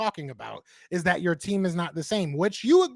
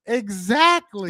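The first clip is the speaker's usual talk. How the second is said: drawn out; 3 dB louder, much slower than usual. Real, not acted. Drawn-out agreement.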